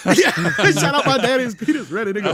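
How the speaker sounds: high, loud, through laughter; laughing. Real, not acted. Friend repeating a silly movie line, laughing. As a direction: quote it while cracking up. Quoting a line while cracking up.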